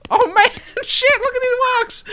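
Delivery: high, loud laughing amazement; real laughing outburst. Real, not acted. Player seeing the silly walks, real laughing outburst. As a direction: crack up and exclaim over it.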